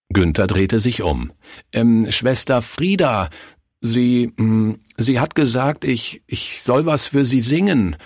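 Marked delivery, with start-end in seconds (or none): 1.7-7.9 put-on higher, hesitant boy's stammer. A narrator's reading. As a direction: stammer it out haltingly, pitched up. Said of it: "stammelte er". (verlegen stammelnd, mit höherer Stimme)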